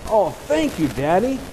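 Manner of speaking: put-on boy's high, loud thank-you; little boy beaming, calling out thanks; excited yell. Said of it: "he yelled".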